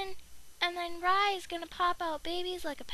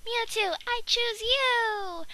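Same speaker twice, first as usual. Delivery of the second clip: very slow, higher, grand battle cry; Pokemon trainer call; 4 semitones higher, much slower than usual. Pretend. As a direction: call out the Pokémon like an anime hero. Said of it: "he's all like".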